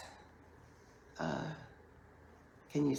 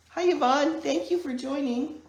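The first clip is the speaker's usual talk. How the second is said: cheerful greeting; 11 semitones higher, 6 dB louder than usual. Real, not acted. Greeting a viewer who joined live.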